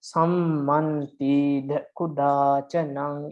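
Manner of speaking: very slow, each syllable held; drawn-out, evenly spaced syllables; syllable-by-syllable counting. Shown as a demonstration. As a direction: count out the verse line syllable by syllable. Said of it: "You can count them".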